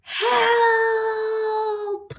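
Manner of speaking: high, loud cry of "Help!"; shout for help. Shown as a demonstration. Teacher modelling a shouted cry for help at a crocodile. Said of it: "everybody ready for shouting".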